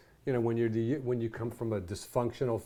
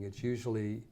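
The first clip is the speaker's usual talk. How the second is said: ordinary talk, nothing marked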